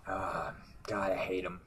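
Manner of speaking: whispered disgust; whispered aside. Real, not acted. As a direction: breathe it out under your breath.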